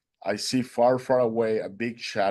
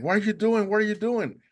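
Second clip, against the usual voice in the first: retold scream; 10 semitones higher than usual. Boatman screaming at the swimmer, high mock yell. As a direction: imitate a frantic scolding from the boat. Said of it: "the guy started screaming at me".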